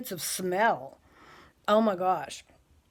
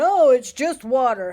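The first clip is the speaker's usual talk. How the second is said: mocking vaper; 5 semitones higher, 10 dB louder than usual.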